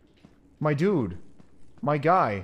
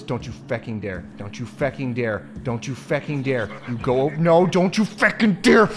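escalating warning; 3 semitones lower than usual. Real, not acted. Player warning the game enemy, increasingly frantic. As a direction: repeat the threat, climbing into a yell.

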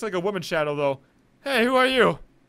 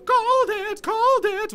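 high, laughing called it; laughing gloat; 4 dB louder than usual. Real, not acted. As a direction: sing out your victory. Gloating after being right.

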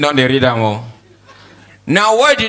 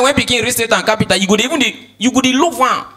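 ordinary delivery

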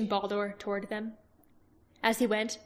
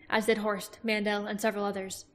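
ordinary delivery

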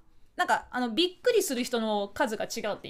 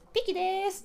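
high-pitched mock self-introduction; impersonator's catchphrase; 8 semitones higher than usual. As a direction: stretch the greeting out, pitched up. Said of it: "そういうモノマネ芸人さん".

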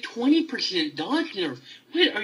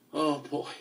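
ordinary delivery

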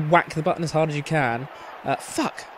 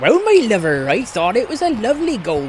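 lilting rustic yokel voice; Country Bumpkin; 4 semitones higher than usual. Acted. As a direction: answer as a daft country pundit. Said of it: "So, Country Bumpkin".

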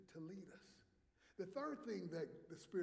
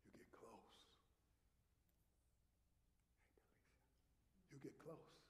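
low, hushed, close-up whisper; 4 semitones lower, 17 dB quieter than usual. Shown as a demonstration. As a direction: whisper as you lean in close. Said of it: "Here's what happens when you whisper".

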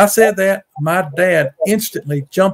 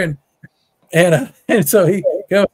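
ordinary talk

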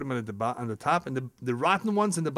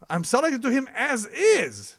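smug seller; 9 semitones higher, 5 dB louder than usual. Retold or imitated. Mocking sly car seller.

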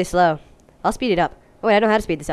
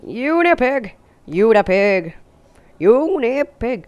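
raised, stretched-out call; drawn-out calling; 3 semitones higher, 4 dB louder, slower than usual. Pretend. Player keeps calling the pig in the game, playful drawn-out calls. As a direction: call the name out, swooping high. Repeatedly calls pig's name.

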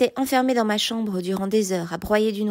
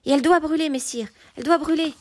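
higher, raised insistent voice; urgent call for punishment; vehement demand; 6 semitones higher, faster than usual. A narrator's reading. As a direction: press hard for the sentence. Mordred réclame le bûcher.

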